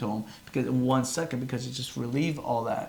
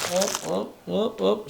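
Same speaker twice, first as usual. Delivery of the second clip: playful whoop whoop; 5 semitones higher, slower than usual. Real, not acted. Phone slipping, playful alarm.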